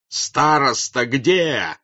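loud, very drawn-out demand; shout. A narrator's reading. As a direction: bellow the question slowly. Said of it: "кричал Ростов".

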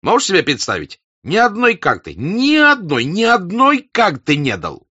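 very high, loud outraged rant; furious rant. A narrator's reading. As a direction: shout out the outrage. Denisov rants cards.

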